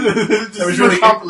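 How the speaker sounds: loud, slow laughing repeat. Real, not acted. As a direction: laugh through the words.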